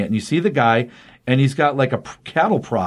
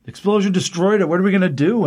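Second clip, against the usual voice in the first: higher-pitched mock panic; retold freak-out; 5 semitones higher than usual. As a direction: imitate stranded guys freaking out. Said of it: "are freaking out".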